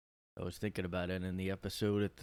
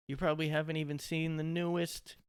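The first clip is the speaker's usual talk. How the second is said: nerd taunt; 8 semitones higher, 3 dB louder than usual. Retold or imitated. Mimics nerds taunting him.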